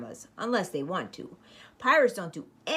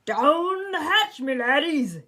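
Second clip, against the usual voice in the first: higher, louder, slow table bellow; pirate captain yell; 8 semitones higher, 7 dB louder, slower than usual. Acted. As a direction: pound the table and roar it. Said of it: "pounded his fist on the table and yelled".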